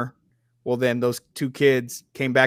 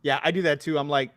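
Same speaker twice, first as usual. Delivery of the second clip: usual speaking voice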